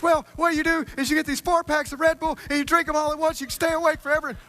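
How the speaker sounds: high excitable voice giving odd advice; Billy explaining. Retold or imitated. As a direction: sound hopped up on energy drinks.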